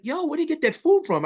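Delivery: high, eager shout across the room; excited call-out. Retold or imitated. Warren asking strangers about their food, eager and high. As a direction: call out, hungry and curious. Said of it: "I'm like".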